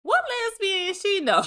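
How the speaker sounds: incredulous outburst. Retold or imitated. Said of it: "Like, I was like".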